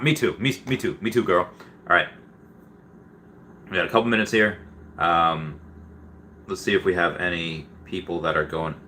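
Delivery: ordinary talk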